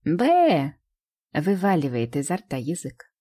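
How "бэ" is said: tongue-out blegh, loud and high; dramatic blegh. Acted. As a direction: play dead with a big blegh. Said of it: "С драматическим".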